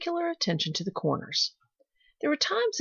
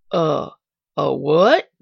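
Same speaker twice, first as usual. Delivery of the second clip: louder, disbelieving a what; old gardener straightening up in surprise; startled demand; 3 semitones lower, 8 dB louder, slower than usual. A narrator's reading. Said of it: "demanded the old man".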